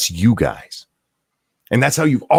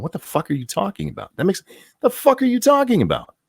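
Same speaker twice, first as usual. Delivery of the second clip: mock baffled; 9 semitones higher than usual. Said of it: "sitting around going".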